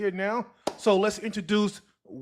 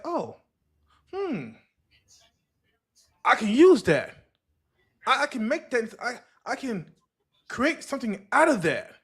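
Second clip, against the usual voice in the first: higher-pitched, dawning-idea musing; sudden inspiration; 6 semitones higher than usual. Retold or imitated. Creator spotting an idea on the street, musing with dawning realization. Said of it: "see something like".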